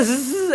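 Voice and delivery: drawn-out firecracker hiss; long sizzling "zzz" imitation; imitated fuse hiss. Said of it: "मुझे आवाज़ आई उसमें से".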